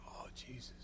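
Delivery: real, barely audible choked whisper; overcome with emotion. Real, not acted. Speaker reliving his conversion, overcome and whispering. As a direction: whisper through rising tears. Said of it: "Sorry, y'all, I get emotional".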